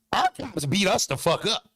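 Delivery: usual speaking voice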